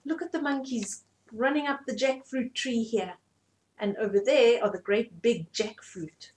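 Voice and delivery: plain reading voice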